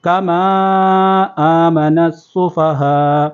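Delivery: کما آمن السفہاء slowly with long vowels; stretched kamaa. Shown as a demonstration. Teacher stretches کما آمن السفہاء. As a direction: draw out the madd on کما. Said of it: "یہاں پر مد ہے".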